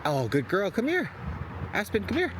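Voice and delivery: very high praise and recall; squeaky upbeat call to the dog; high-pitched dog call. Real, not acted.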